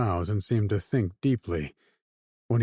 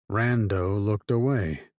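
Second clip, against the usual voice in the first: ordinary delivery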